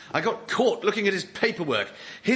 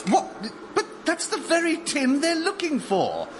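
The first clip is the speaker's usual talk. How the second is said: much higher voice in alarm; startled realisation; 12 semitones higher than usual. Acted. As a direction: jolt upward in shocked discovery. Sudden realisation about the tin.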